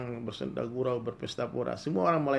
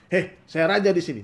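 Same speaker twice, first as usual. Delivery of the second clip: higher, louder indignant demand; indignant shout; 7 semitones higher, 10 dB louder than usual. Acted. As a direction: bark at the guard to let you in. Youth demands entry from guard.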